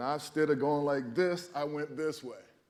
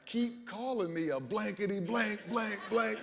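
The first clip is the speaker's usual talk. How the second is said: mock yell; 8 semitones higher than usual. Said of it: "I keep yelling at him".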